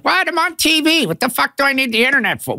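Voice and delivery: high-pitched, cocky mock outrage; mock indignation. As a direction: protest in a squeaky, indignant voice. Mocks his cocky younger self's retort.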